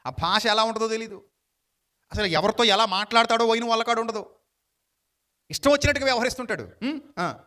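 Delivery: ordinary talk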